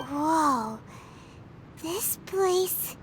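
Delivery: low, drawn-out astonished whoa; breathy wonder; awed whoa. Acted. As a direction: gasp at the strange place. Paimon sees strange realm.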